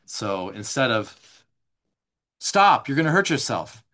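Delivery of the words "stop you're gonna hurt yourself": a louder, harsh scolding. Retold or imitated. Parent scolding a child in a harsh, louder tone. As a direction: snap at the child with contempt. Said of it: "via the tone of the voice".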